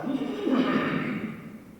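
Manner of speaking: high, falling horse whinny; imitated horse noise. Man imitating his proud horse, high whinny. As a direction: mimic a horse whinnying, pitch sliding down. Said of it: "making his noises and stomping the ground".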